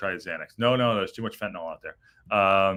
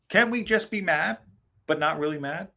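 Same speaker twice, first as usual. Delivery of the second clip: mocking voice; 6 semitones higher than usual. Streamer mocks viewers urging calm, in a high sarcastic voice. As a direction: mimic the soothing chat sarcastically.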